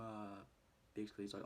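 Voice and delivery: normal talking voice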